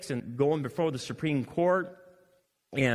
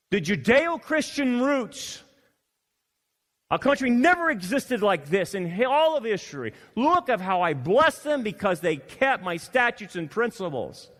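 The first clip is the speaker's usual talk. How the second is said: preaching scripture; 9 semitones higher, 6 dB louder than usual. Acted. Preaching at full force.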